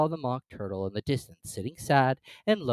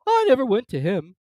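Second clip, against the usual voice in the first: sighing; 12 semitones higher, 9 dB louder than usual. Acted. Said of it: "with a sigh".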